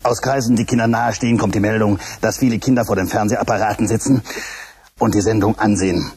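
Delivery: low, formal broadcast announcer voice; announcer read. Acted. Announcer introducing the show to children, formal news read. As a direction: read it like a stiff news bulletin.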